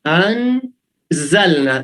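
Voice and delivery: انزلنا said slowly with a plain Urdu z; drawn-out anzalna. Shown as a demonstration. Teacher showing the class the z sound in انزلنا, slowly. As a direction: stretch انزلنا with the ordinary z sound. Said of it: "زے، زے وہی normal ہے".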